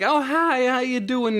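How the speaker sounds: bright, high, polite hello; polite friendly greeting. Retold or imitated. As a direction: greet them sweetly and politely. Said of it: "and you're like".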